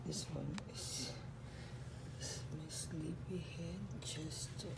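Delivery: low, quiet murmur, almost a whisper; hushed introduction. Real, not acted. Introducing sleepy pet Chester to viewers, hushed. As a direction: keep it down as if beside a sleeper.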